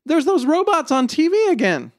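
put-on very high, level little-kid voice; high-pitched child pointing something out; six-year-old girl. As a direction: announce it like a small child. Six-year-old twin speaks.

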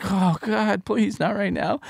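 imitated pained pleading; retold exasperated plea. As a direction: beg for a break, half-acted. Retold plea as child refuses.

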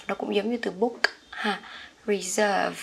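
normal talking voice

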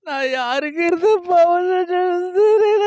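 slow, sung-out crying wail; keen it out like a mourning song; a high, sobbing singsong lament. Acted. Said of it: "இப்படி நீ அழுது".

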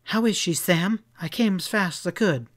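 worried, insistent questioning; urgent demand. A narrator's reading. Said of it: "grabbed his arm and demanded".

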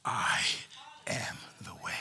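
whispered low and soft; hushed whisper. Acted. Preacher voicing Jesus answering his disciples, whispered. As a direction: breathe it almost silently.

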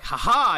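gloating imitation laugh; mock triumphant laugh. Rishi gloating before a punch, mock loud laugh.

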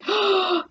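high, audible intake of breath; shocked storyteller's gasp; sharp gasp. A narrator's reading. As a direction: gasp in horror. Narrator gasps before the scream.

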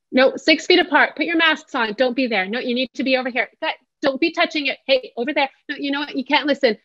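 high, sharp bossing about; teacher-parent barking rules and no; bossy no tone. Shown as a demonstration. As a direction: bark orders at the group. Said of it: "This is what our children are hearing".

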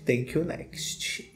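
whispered quote. Retold or imitated. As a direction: breathe it out slowly. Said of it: "Como diria a [name]". (sussurrando devagar)

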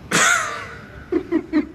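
high burst of laughter; amused laugh. Acted. Granddaughter amused by her impossible grandfather, laughing.

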